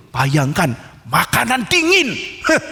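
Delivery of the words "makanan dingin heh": high, slow whine of disgust; a higher, slow moan about the cold food; whiny complaint. Acted. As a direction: sulk over the cold food, drawn out.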